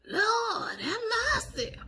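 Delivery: high squealing Lord have mercy, half laughing; high exasperated cry. Retold or imitated. Sticky hands make her cry out high, mock dismay. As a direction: throw your voice up high in mock despair. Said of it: "I'm just like".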